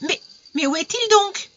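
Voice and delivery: very high, anguished outcry; crying out. A narrator's reading. Emelina pulling away from her fiancé, desperate cry. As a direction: cry out in frantic impatience. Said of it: "elle s'écria".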